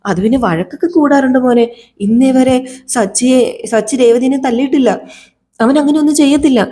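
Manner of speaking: a mother swearing her son is innocent; pleading grandmother. Acted. Grandmother defends Sachi again.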